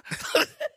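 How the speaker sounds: airy high-pitched laugh; high breathy laugh. Real, not acted. Laughing at his own story, a breathy high laugh. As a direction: burst out laughing silently.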